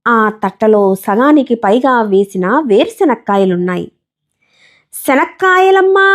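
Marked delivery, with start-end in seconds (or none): 4.9-6.1 vendor's call. Acted. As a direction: call out your wares down the train. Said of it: "ఆమె మళ్ళీ అరిచింది". (కేకలా అమ్మకం అరుపు)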